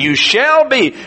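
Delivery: firm weight on SHALL; emphatic statement. Shown as a demonstration. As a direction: hit "shall be" as an emphatic promise. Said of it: "That's an emphatic statement".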